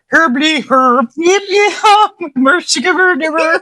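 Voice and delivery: high, loud Muppet Swedish Chef gibberish; mock Swedish Chef, hammed-up and squeaky. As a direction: play the Muppet chef singing nonsense. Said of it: "Swedish from the Muppets".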